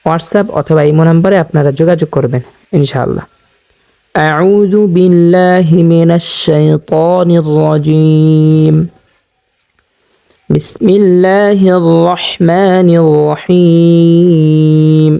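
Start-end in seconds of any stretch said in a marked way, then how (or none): 4.2-8.8 slow, drawn-out tajweed chant; Quranic recitation. Shown as a demonstration. Teacher opening the lesson for viewers, slow melodic recitation. 10.5-15.1 slow, drawn-out tajweed chant; Quranic recitation. Shown as a demonstration. Teacher opening the lesson for viewers, slow melodic recitation.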